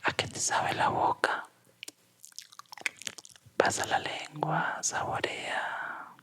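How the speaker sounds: slow whispered guided meditation; right in your ear. Acted. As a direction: whisper close, slow and soothing. Whispered guided exercise, eyes closed.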